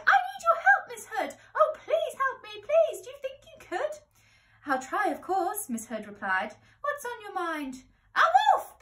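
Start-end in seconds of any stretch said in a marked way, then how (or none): 0.0-4.0 very high, loud pleading squeal; frantic pig cry. A narrator's reading. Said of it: "Pig cried". 4.7-5.7 higher, ladylike reply; obliging young lady on the phone. Acted. 6.8-7.8 higher, ladylike reply; obliging young lady on the phone. Acted. 8.1-8.8 very high, loud piglet shriek; alarmed cry. A narrator's reading. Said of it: "Pig cried".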